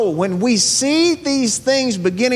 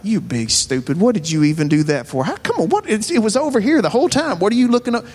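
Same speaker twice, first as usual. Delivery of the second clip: mock self-scolding; 2 semitones higher, much faster than usual. Muttering to yourself after a mistake, mock exasperated self-scolding. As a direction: scold yourself in rising exasperation, half in jest. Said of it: "and you're like".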